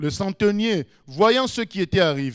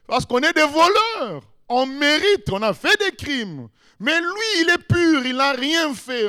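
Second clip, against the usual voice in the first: raised, high-pitched protest; acted indignant defence, pushed louder in bursts; earnest rebuke; 5 semitones higher, 4 dB louder than usual. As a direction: plead his innocence emphatically to your fellow thief. Acted thief defends innocent Jesus.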